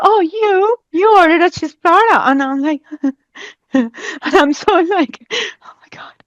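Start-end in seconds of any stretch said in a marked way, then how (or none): 0.0-2.2 high, bright waitress pointing her out. Retold or imitated. Waitress tells her she ordered the platter, high and cheery. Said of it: "she goes like". 5.6-6.1 panicked whisper to herself. Retold or imitated. Inner panic at the lunch table, whispered.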